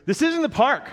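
put-on high, concerned little-boy voice; worried kid. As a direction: mimic a worried preschooler. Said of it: "He goes".

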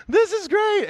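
high, loud squeal of delight; excited little kid. Pretend. Little kids seeing fire trucks as the parade, excited squeal. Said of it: "little kids are like".

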